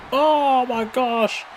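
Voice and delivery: high, drawn-out amazement; amazed. Real, not acted. Commentator reacting to a big wrestling move, amazed. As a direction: stretch it out, high, in real astonishment.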